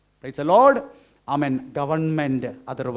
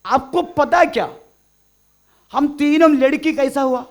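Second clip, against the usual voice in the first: imitated schoolgirl question; 8 semitones higher, 8 dB louder than usual. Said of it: "fifth standard पढ़ने वाली लड़की ने पूछा".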